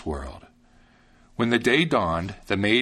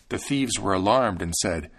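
usual speaking voice

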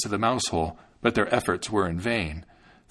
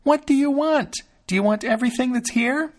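high, loud scream; Thumbling screaming to rouse the maid; 4 dB louder than usual. A narrator's reading. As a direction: yell it out to wake the house. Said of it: "screamed once more".

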